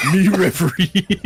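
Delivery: caveman voice. Retold or imitated. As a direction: grunt it out like a caveman. Caveman talk.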